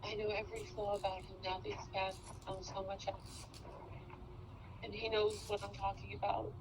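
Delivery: low, soft, tearful confession; quiet grief. Real, not acted. Widow quietly forgiving her late husband, low and tearful. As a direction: hold back tears as you forgive him.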